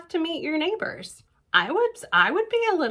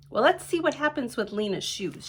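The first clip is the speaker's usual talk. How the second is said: usual speaking voice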